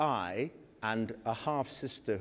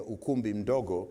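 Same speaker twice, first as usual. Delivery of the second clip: ordinary talk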